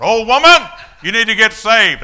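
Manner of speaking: a high, full-throated scream across the house. Retold or imitated. Newly saved father yelling at his wife from the doorway, screaming. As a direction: bellow it through the open door. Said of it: "he screams out".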